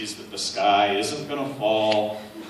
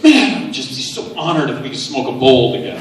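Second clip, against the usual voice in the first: excited fan; 3 semitones higher, 10 dB louder than usual. Retold or imitated. Chairlift stranger gushing to Gary about smoking together, loud and laughing.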